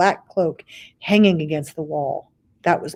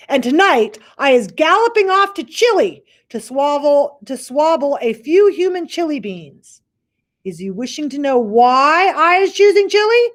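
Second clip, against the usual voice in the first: high, loud boastful giant; gloating giant; 9 semitones higher, 7 dB louder than usual. Acted. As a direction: tease him with the plan. Giant gloats about Chile.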